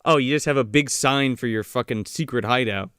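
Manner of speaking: ordinary talk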